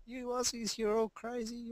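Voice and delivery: high, shocked falsetto of a horrified clerk; alarmed hotel clerk. Retold or imitated. Hotel clerk scolding the Aussie guest, high shocked falsetto. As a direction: play the appalled clerk, voice shooting way up.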